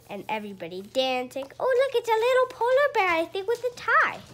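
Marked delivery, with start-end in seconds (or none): 1.6-4.2 bright high delight at the picture; excited. Real, not acted. Parent showing a child a polar bear, excited.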